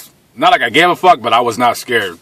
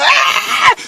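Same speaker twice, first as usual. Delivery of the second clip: startled cry. Retold or imitated. Braking hard in chase.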